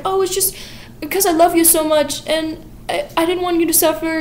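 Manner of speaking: high, falsely sweet pleading; lightly voiced coy excuse; sugary Mrs. Lovett. Lovett excuses herself to Sweeney.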